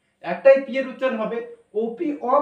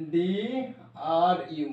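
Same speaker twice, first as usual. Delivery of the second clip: DRU drawn out letter by letter, "D... R... U"; slow spelling; 3 semitones lower, much slower than usual. Shown as a demonstration. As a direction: stretch each letter of DRU very slowly. Same letters repeated very slowly. (DRU, প্রতিটি অক্ষর টেনে টেনে ধীরে)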